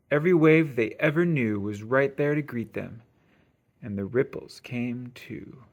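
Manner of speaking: plain reading voice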